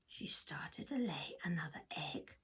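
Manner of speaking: hushed whispered narration. A narrator's reading. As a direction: whisper the suspense.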